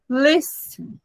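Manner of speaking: Listen with a high, strong first syllable; "Listen" demonstrated with the opening syllable stressed. Shown as a demonstration. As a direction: lean on the start of "Listen". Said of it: "A mais forte é a primeira".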